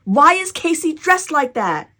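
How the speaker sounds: loud, high, drawn-out protest; indignant big sister. A narrator's reading. Jessie complains to Mama about her brother's outfit, indignant. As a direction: demand an answer, slow and outraged.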